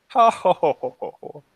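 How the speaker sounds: loud jolly ho-ho laugh that fades out; hammy ho ho laugh. Acted. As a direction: belt out a jolly ho ho ho. Hammy laugh after impression.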